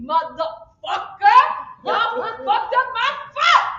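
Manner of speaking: loud, slow angry customer; hammed-up irate phone call; furious complaint. Angry customer calls restaurant back.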